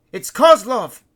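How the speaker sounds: high, loud cry of discovery; excited shout. A narrator's reading. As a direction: shout the sighting to the boat. Spotting a survivor's flare.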